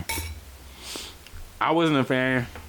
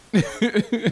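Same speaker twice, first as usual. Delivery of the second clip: high giggle; 13 semitones higher than usual. Real, not acted. Laughs at episode cut.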